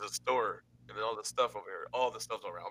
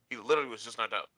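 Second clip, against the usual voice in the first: usual speaking voice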